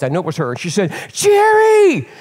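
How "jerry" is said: high, loud imitated call of a name; sister's cry. Sister crying out to her brother in prayer, high imitated shout. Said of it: "I heard my sister's voice".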